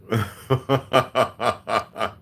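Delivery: real staccato belly laugh; hearty laugh. Real, not acted. Streamer laughing at his chat, amused cackle. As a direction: laugh in a steady run of ha-has.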